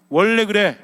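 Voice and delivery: loud, defensive snap back; angry outburst. Acted. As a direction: burst out with a mock defensive retort. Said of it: "이러면서 튀어나오죠". (욱해서 큰 소리로 받아치기)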